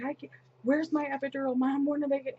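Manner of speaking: mock frantic whine for help; retold panicked plea. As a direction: plead urgently, as if quoting her panic. Acts out daughter's panicked plea in labor.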